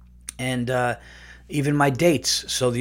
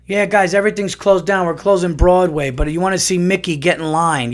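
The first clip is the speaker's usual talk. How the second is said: mocking announcement, pitched up and louder; mock official; 6 semitones higher, 5 dB louder than usual. Mocking the officials who close Broadway but keep Disney open. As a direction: sneer like an official making excuses.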